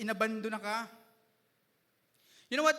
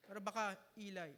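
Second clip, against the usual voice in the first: softer, offhand Eli; quiet wrong take; 3 semitones lower, 7 dB quieter than usual. Shown as a demonstration. Preacher showing how not to say Jesus's cry, quietly. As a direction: say it small, the wrong way. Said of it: "loud voice to kasi".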